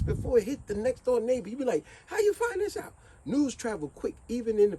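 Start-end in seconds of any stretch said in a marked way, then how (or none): 2.1-2.9 amazed. Retold or imitated. Amazed at prisoner's news.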